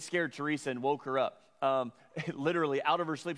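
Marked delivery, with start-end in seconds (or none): none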